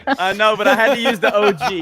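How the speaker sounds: laughing through the words. Real, not acted. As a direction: giggle while you talk. Laughing at the prank song.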